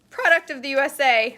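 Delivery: loud, high squeak on the words. Real, not acted. Vlogger reading the can label proudly, voice squeaking high and loud. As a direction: proclaim it till the voice squeaks. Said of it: "my voice just squeaked".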